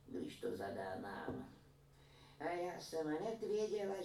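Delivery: lightly voiced old woman recounting; granny telling how they made it. A narrator's reading. Old woman telling her story. (lehce naznačená vyprávějící stařenka)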